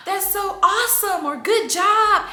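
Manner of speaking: put-on high, bright-eyed cheering for a toddler; excited praise. Shown as a demonstration. Mom cheering her toddler's small win, high excited praise. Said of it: "I'm like".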